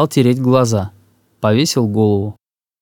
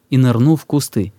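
plain reading voice

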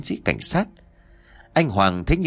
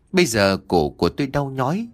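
plain reading voice

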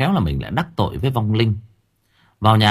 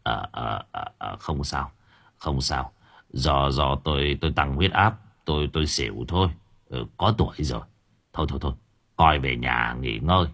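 put-on low, soft, halting old man; evasive; 8 semitones lower, 7 dB quieter than usual. A narrator's reading. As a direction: brush it off weakly, stumbling over the words. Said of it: "nói làng". (giọng trầm nhỏ, ấp úng nói lảng)

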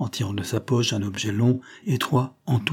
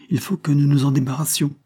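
higher, pressing declaration; singular, pointed delivery; 5 semitones higher, 4 dB louder than usual. A narrator's reading. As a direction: urge it with raised pitch. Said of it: "furent prononcés par l'agent de police d'une façon singulière". (d'un ton singulier, pressant)

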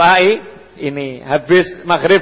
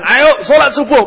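raised, higher call to get up; 7 semitones higher, 4 dB louder than usual. Retold or imitated. Father calling his child to dawn prayer, raised voice.

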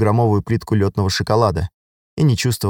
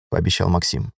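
usual speaking voice

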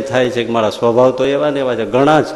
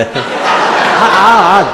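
laughing; 7 semitones higher, 5 dB louder, much slower than usual. Real, not acted. Preacher mocks distracted meditation.

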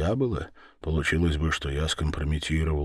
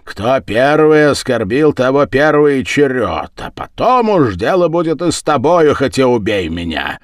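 loud, high, heated argument; raised-voice challenge; defiant insistence; 8 semitones higher, 9 dB louder than usual. Acted. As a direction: insist stubbornly at full voice.

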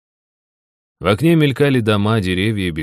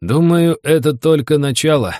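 raised, emphatic, knowing delivery; meaningful pronouncement; 7 semitones higher, 5 dB louder than usual. A narrator's reading. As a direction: pronounce it as if you know more. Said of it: "многозначительно изрёк Серёга". (многозначительно изречь)

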